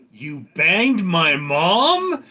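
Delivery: slow, loud, high disbelieving yell; a father's appalled slow shout; outraged dad. Acted. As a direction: drag it out in horror.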